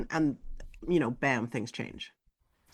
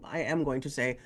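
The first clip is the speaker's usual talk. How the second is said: ordinary talk, nothing marked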